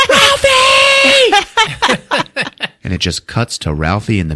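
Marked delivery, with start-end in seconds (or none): 0.1-1.3 very high, loud shriek; scandalized mock scream. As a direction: scream the name as an outraged mother. Mom screams her son's name.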